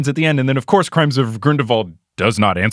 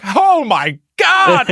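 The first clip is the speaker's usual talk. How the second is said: very high, slow, scandalized exclamation; hammed-up outrage; mock shocked gasp; 4 dB louder, much slower than usual. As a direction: stretch it out like you're appalled. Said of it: "and then Tina's like".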